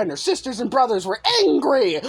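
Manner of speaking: usual speaking voice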